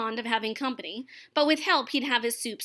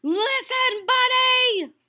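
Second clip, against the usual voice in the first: loud high yell of his name; 12 semitones higher, 7 dB louder, much slower than usual. Acted. Parents yelling for Buddy, loud and high. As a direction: yell it across the yard. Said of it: "His parents tried yelling".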